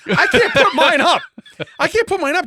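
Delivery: high, laughing disbelief at himself. Real, not acted. Husband laughing while retelling the window mix-up. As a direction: squeal it out through laughter.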